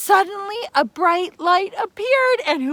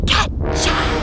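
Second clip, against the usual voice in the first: much lower, menacing villain name; monster name reveal; 13 semitones lower, 4 dB louder than usual. Acted. Storyteller revealing the monster with a deep menacing voice. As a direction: drop into a deep ominous growl.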